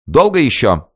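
impatient lady raising her voice, high and loud. A narrator's reading. Impatient lady raises her voice at the guard, loud and high. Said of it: "повысила голос дама".

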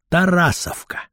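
ordinary delivery

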